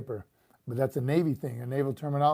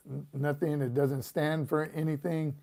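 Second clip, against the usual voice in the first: normal talking voice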